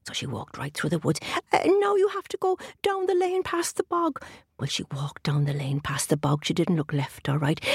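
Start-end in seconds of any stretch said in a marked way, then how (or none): 1.5-4.2 leprechaun. A narrator's reading. Leprechaun gives directions.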